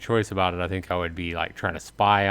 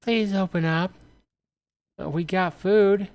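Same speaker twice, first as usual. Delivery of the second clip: pleading woman; 10 semitones higher than usual. Acted. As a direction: bargain through the door, pitched up. Stranger begging with food offer.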